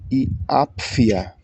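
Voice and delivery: "apfia" said slowly as ap-fi-a, falling at the end; careful Greek reading. Shown as a demonstration. Teacher to students sounding out "apfia" slowly. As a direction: spell out "apfia" syllable by syllable. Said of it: "teacher sounding out Greek".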